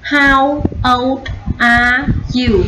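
put-on slow, each word of "How old are you" separate. Shown as a demonstration. Teacher models the question for pupils, slow word by word. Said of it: "Cô đọc nối, đọc nhanh".

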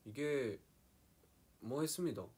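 sing-song it high in Gangwon dialect. Shown as a demonstration. Showing fans Gangwon speech, high Gangwon accent. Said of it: "강원도는 약간".

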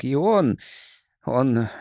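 usual speaking voice

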